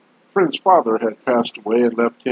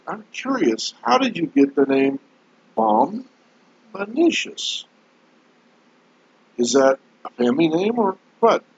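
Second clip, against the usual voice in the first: curious friend; 9 semitones higher than usual. Retold or imitated. Friend questions the gardener.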